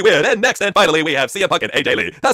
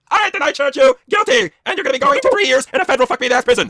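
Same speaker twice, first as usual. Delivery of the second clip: mock judge; 7 semitones higher than usual. Judge delivers verdict.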